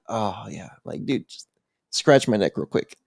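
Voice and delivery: low, soft stoned murmur; blissed-out mumble. Retold or imitated. High, enjoying a hand on him.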